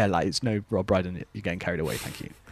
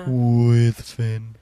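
announcer tag; 7 dB louder than usual. Acted. Jingle tag line.

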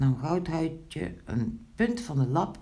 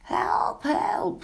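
very high, airy storybook scream; shrieking. A narrator's reading. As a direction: cry for help high and thin, without full volume. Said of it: "gilde Zwart Snoetje". (hoog gillend om hulp)

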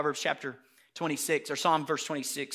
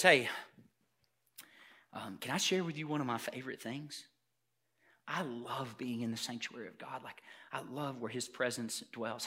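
soft, low, tentative confession; little boy; 7 semitones lower, 7 dB quieter than usual. Acted. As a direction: share it gently, like a shy child. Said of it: "Little boy says".

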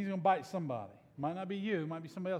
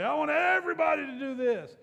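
bossy worship leader; 10 semitones higher, 8 dB louder than usual. Retold or imitated. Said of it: "the worship leader would say".

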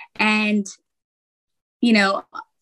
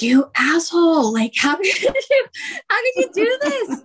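high mock wail through laughter; comic outraged protest; 11 semitones higher than usual. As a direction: protest to your husband in pretend outrage, pitch climbing very high. Retells protesting to her husband, laughing.

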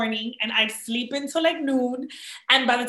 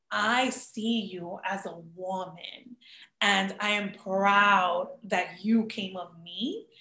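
low, slow, soft retelling of a proud mother; woman to woman; 4 semitones lower, 3 dB quieter, slower than usual. Retold or imitated. Mother addressing her grown daughter as a woman, tenderly solemn. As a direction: speak slowly and gently, giving weight to each word. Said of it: "the way that she spoke to me was like a woman".

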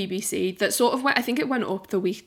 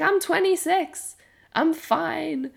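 higher, louder mock self-assurance; breezy denial; 9 semitones higher, 4 dB louder, slower than usual. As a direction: quote your past self insisting all is well. Quotes own past self, dismissive.

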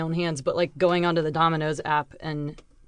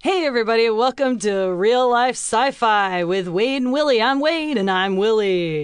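loud, slow, peppy TV host intro; MTV host voice; 5 semitones higher, 6 dB louder than usual. Acted. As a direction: sell the intro like a music TV host. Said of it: "you sound like one of those M T V".

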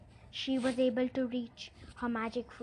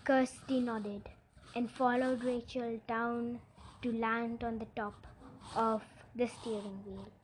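ordinary delivery, nothing marked

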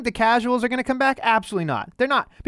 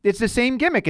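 ordinary talk

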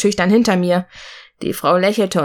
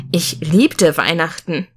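plain reading voice

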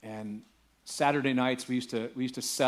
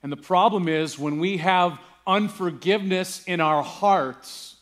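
loud, high, ringing preacher's call; full-force preaching; 6 semitones higher, 8 dB louder than usual. Acted. Pastor preaching to the congregation with full-force fervor. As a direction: drive the point home to the whole church.

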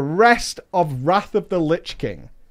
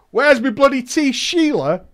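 higher-pitched put-on Australian dad; mock Australian yell; 7 semitones higher, 3 dB louder than usual. Dad calling to mum for tea, mock Australian yell. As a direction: lightly bellow it in a mock Australian accent. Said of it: "Dad yelled".

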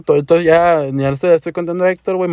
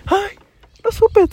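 much higher, rehearsed "ay, no supe"; fake surprise; much slower than usual. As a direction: play acting surprised, overdone. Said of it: "practicando cómo hacerme el sorprendido".

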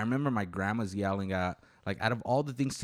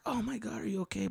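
mom's voice, much higher; a worried mother bursting in; light mom voice, pitched up; alarmed mom; 12 semitones higher, 3 dB quieter than usual. Retold or imitated.

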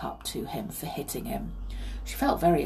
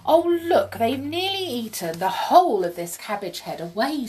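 put-on higher, lively child's exclamation; excited exclamation; 6 semitones higher, 5 dB louder than usual. A narrator's reading. Molly finds the eaten cabbage, excited. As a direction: exclaim with a high, punchy start.

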